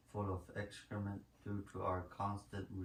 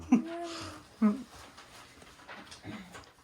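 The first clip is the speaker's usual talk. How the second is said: hearty laugh; 8 dB louder than usual. Real, not acted. Laughing at own diarrhea story.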